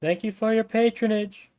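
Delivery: high, polite megaphone announcement. Acted. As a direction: thank them over a megaphone. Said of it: "the megaphone voice goes".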